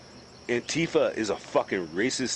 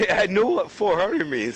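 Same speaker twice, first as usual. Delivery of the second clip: higher, louder laughing retort; 8 semitones higher, 5 dB louder than usual. Real, not acted. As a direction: laugh it off while answering. Laughing reply to his friend.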